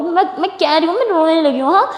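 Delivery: high, whiny threat to cry; mock tearfulness. A narrator's reading. Sia threatens to cry too, hammed-up whimpering. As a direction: build from whining into a fake wail. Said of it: "तो ऐसे कहने लग जाती".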